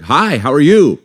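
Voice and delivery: high, loud, over-friendly hello; mock eager hailing; imitated call across the road. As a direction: call out a greeting to someone across the street. Acts out greeting people across the road.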